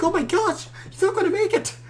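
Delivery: high, hammed-up despair; a friend pretending to lose a patient, overacting; mock panic. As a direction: overplay the panic of a TV medic. Friend mock-panicking during fake CPR.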